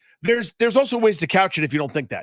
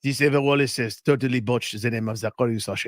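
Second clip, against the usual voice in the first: mock French accent; 5 semitones lower than usual. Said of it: "you could do a good French guy".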